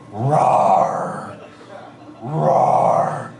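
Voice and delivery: put-on low, playful drunken "rawr"; drawn-out mock roar. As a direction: roar like a drunk playing monster. Drunk [name] pawing at him.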